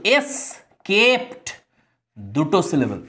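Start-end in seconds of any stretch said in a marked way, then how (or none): 0.0-1.5 escaped split slowly, এস then কেপড; broken into syllables. Shown as a demonstration. Teacher counting syllables for students, escaped slowly split in two. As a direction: pull escaped apart into two long, higher syllables. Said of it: "যদি আমরা সিলেবেলে ভাঙি".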